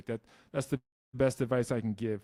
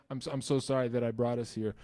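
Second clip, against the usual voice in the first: ordinary talk, nothing marked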